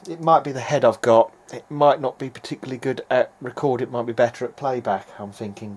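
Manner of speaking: ordinary talk, nothing marked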